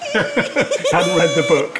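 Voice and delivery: slow, drawn out through laughter; giggling mock accusation; laughing tease. Real, not acted. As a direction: tease her while laughing. Teasing guess, laughing.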